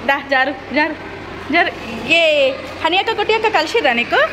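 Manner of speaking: cheer, then ask with pitch lifted high. Real, not acted. Mother asks her little son whether he met the older girls, excited.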